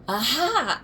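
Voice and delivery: triumphant loud Aha; shout. Acted. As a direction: call it out like a detective catching someone. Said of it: "I shout".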